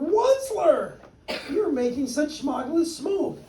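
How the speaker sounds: the Lorax scolding the Onceler over the smog. Acted. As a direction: scold in a high little voice. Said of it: "he cried with a truffle in his throat".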